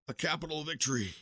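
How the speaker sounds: a weak croak from a dying man; a hint of a croak, wounded commander to the colonel. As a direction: force the words out in a croak. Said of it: "he croaked".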